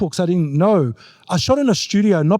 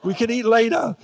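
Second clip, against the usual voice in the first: pleading son; 5 semitones higher, slower than usual. Retold or imitated. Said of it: "I go".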